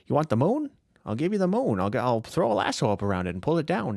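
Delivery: put-on old Hollywood leading man voice; James Stewart. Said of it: "James Stewart".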